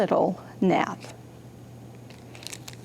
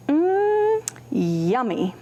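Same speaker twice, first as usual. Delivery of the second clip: hungry bear; 5 semitones higher, much slower than usual. Acted. Reading a picture book to kids, mock yummy. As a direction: savour the cake in mock delight.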